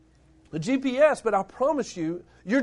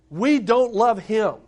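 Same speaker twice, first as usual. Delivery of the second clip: ordinary talk, nothing marked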